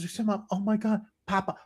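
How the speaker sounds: very high squealing father; hammed-up doting dad; thrilled parent. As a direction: squeal with giddy pride. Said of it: "He goes".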